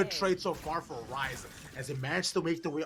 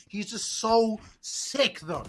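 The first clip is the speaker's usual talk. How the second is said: high, loud, slow praise; excited; 7 semitones higher, 5 dB louder, much slower than usual. Real, not acted. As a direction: stretch it out, amazed. Caster praising the player.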